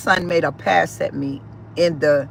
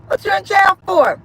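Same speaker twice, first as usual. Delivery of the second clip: high, loud incredulous yell at her son on the jail call; retold shout; 13 semitones higher, 5 dB louder than usual. Mother shouting at her son calling from jail, high and loud. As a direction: hurl the question back in disbelief.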